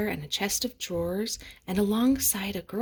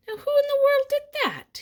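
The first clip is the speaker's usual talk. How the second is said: high, loud startled night watchman; a guard calling out into the dark store; gruff watchman bursting out; watchman exclaiming; 8 dB louder than usual. A narrator's reading. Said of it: "he exclaimed".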